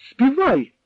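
furious German officer's shout; angry shout. A narrator's reading. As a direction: snap the order in rage. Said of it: "раптом розлютився він".

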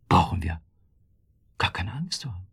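soft hushed whisper; whispering reassurance to her husband. Acted. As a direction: drop to a breath, no voice. Wife reassures husband at night.

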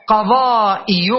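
قضائي slowly, long aa before the hamza; drawn-out vowel. Shown as a demonstration. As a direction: hold the aa in قضائي, then release the ending. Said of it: "كيف سأقول فيها".

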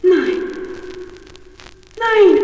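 loud, rising no of despair; dismayed cry. Acted. Daughter cries out at the even bigger heap of straw, loud. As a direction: cry out in horror. Girl sees more straw.

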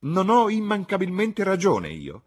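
loud, high, triumphant tirade; outraged matron demanding agreement; indignant outburst. Acted. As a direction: rail away at full voice. Indignant woman rants about tenant.

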